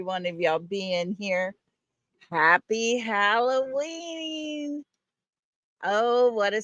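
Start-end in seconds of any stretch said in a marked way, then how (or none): 2.3-4.8 slow, higher, mock-spooky greeting; lightly hammed-up spooky Halloween wish. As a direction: draw it out like a playful ghost.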